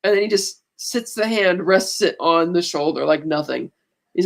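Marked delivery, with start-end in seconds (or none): none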